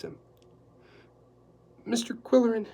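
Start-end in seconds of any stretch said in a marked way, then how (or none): 1.8-2.7 a timid, hesitant housekeeper. Acted. Housekeeper hesitantly getting Quillerin's attention, timid. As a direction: hesitate before his name. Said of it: "she said hesitantly".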